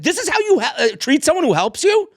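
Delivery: high-pitched, outraged scolding; retold yelling. Man rebuking the woman he helped up, mock yelling. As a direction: replay an indignant telling-off. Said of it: "I'm yelling at the woman".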